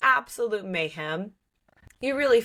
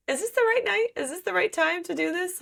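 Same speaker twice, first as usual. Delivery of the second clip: higher, hesitant little voice; mock nervous girl; 10 semitones higher than usual. Reader imitating a nervous heroine, higher. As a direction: play up her first-night jitters.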